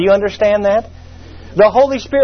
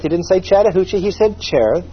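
usual speaking voice

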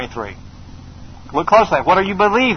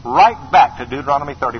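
normal talking voice

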